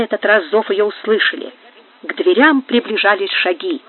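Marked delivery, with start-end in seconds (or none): none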